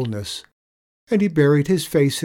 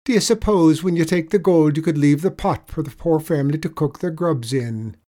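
lightly voiced leprechaun, raised pitch; leprechaun asking a favour; 6 semitones higher, 7 dB louder than usual. A narrator's reading. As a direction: put the request as the leprechaun, louder. Leprechaun asks his favour.

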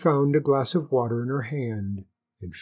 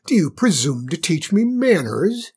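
high, loud outraged question; haughty, rising outrage; indignant cry; 5 semitones higher, 6 dB louder than usual. A narrator's reading. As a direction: demand it like an affronted queen. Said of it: "cried the queen".

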